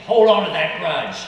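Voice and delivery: hammed-up tempting voice; tempting inner voice. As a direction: voice the bad inner urge boldly. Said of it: "a voice inside saying".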